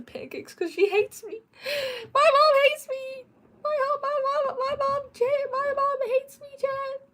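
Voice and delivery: high, loud, hammed-up fake crying; mock wailing. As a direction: whine it like a wounded child. Mock whining that mom hates her.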